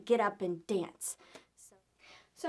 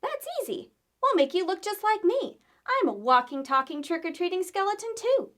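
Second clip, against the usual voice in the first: put-on cheerful girl; 7 semitones higher, 6 dB louder than usual. Girl in skeleton costume speaks.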